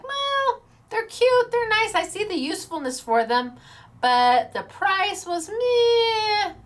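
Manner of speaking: playfully unimpressed, drawn-out meh; mock lukewarm verdict. Crafter retelling her teasing reply to Ozzy, mock unimpressed.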